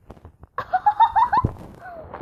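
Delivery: loud high laugh. Real, not acted. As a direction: burst out laughing.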